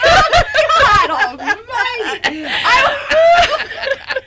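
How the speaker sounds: high, stretched-out cry through laughter; laughing exclamation. Real, not acted. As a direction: gasp it slowly while cracking up. Overcome by the fish joke.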